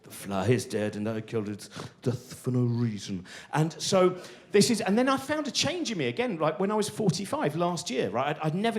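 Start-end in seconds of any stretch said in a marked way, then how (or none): 0.0-3.2 low, soft mock remorse. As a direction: mourn the fly in a hushed voice. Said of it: "You're going".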